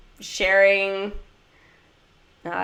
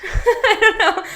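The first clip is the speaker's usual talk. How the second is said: laughing; 14 semitones higher, 4 dB louder than usual. Real, not acted. Laughs at her failed Dutch.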